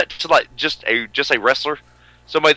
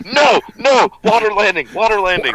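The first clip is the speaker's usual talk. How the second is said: mock alarm; 9 semitones higher, 4 dB louder than usual. Joking crash-landing alarm.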